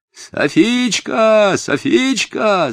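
long, very high calls from a tree; drawn-out distant summons; yelling. A narrator's reading. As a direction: holler the name, stretching it out. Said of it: "снова с дерева заорал Нури".

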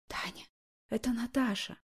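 hushed, far-off phone whisper. A narrator's reading. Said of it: "прошептал в трубку далёкий".

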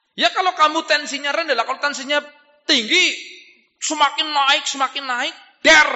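high, loud exhorting voice; urgent, high-pitched preacher's exhortation; full-force preaching. Acted. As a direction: admonish the congregation with ringing force.

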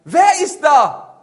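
high, loud, slow call; drawn-out shout. Acted. Hunter to unseen voice, long loud shout. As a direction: bellow it into the forest, stretching the words.

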